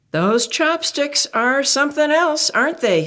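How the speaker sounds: high bright parental reply. Acted. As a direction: play along like a patient parent. Parents voiced in story.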